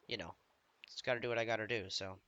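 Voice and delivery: normal talking voice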